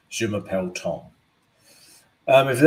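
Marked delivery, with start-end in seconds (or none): 0.1-1.0 a light, hammed-up French accent, softer. Greeting his viewers in a mock French accent. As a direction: show off your schoolboy French. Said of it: "I apologize for the accent".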